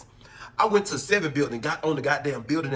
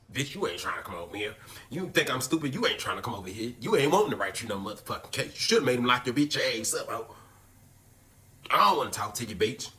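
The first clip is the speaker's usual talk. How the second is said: put-on lower, softer grumbling boyfriend voice; imitating boyfriend; 5 semitones lower, 4 dB quieter than usual. Jealous boyfriend scolding him for leaving, low resentful voice. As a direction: drop low and sulk through the scolding.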